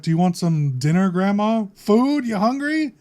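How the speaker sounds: imitating Walter. Walter offering Grandma dinner, imitated with climbing, high-pitched questions. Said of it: "Walter's like".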